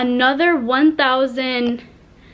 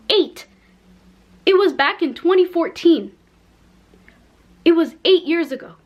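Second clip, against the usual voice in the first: Eight punched out higher, then It was back in twenty fourteen and It was eight years ago kept raised and pressed; leaning hard on each Eight to make the point clear; stressing eight; 4 semitones higher than usual. Real, not acted. Stressing to the viewers that her passport photo was eight years old, pressing each Eight. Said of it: "let me make it very clear".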